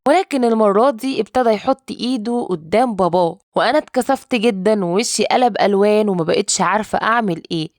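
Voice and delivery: usual speaking voice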